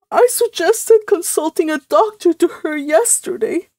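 high, tearful moan. A narrator's reading. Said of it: "moaned Missus Frederick".

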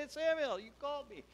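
put-on very high-pitched, eager child; young boy Eli. Boy going back to the priest, very high eager voice. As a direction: pipe up like a boy hurrying in. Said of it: "He goes to Samuel".

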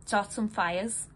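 measured delivery with sudden bursts, dropping away between; bitter dramatic monologue. Acted. Partner proposing champagne and riots, bitterly resolved. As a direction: push through in broken phrases, pausing heavily.

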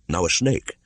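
usual speaking voice

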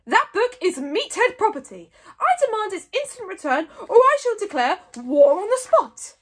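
high, loud outraged demand; booming. A narrator's reading. Mogadon demanding his library book back, booming. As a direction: thunder out a threat of war. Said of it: "boomed Mogadon".

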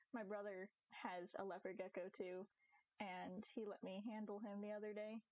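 ordinary talk, nothing marked

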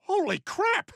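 high, loud "Holy crap!"; shocked out of your wits; shocked exclamation. Acted. Father shocked by daughter.

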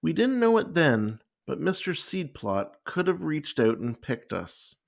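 ordinary delivery, nothing marked

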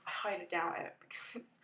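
whispered aside. Real, not acted. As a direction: drop to a whisper. Aside about an obscure reference.

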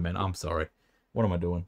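ordinary delivery

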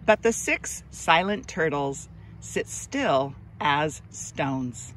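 put-on slow, expressive read-aloud for children; gentle storybook narration, drawn out; story narration. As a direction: read it like a picture book to little kids, lingering on each line. Reads picture book aloud.

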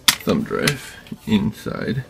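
ordinary talk, nothing marked

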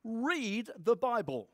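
ordinary talk

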